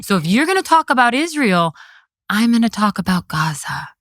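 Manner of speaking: put-on high, spelled out as if to small children; talking down. Commissioner telling politicians what she'll say, talking down. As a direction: lecture them like five-year-olds. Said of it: "talking to these politicians like they're five-year-olds".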